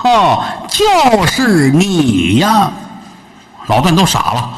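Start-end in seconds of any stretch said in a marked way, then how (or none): 0.0-2.7 scornful drawl. Acted. Repeated scornful recognition.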